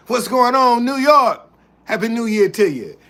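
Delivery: high, loud hype greeting; big, loud, high-pitched welcome; excited shout-out. Real, not acted. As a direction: call out to the crowd like a host. Greeting viewers by city.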